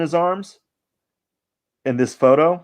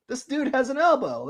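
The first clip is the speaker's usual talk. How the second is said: much higher, playful squeaky voice; mock high squeak; 13 semitones higher than usual. Artist joking to viewers about his sketch, silly high voice. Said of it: "playful repeat of the line".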